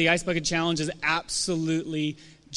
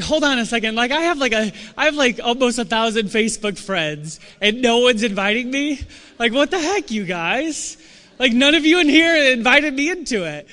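rising higher and louder to an indignant call-out; mock outrage; 5 semitones higher, 5 dB louder than usual. Pastor acting out being left out, mock outraged. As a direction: build to a hammed-up protest.

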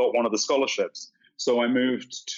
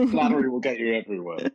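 laughing; faster than usual. Real, not acted. Laughing reply to a compliment.

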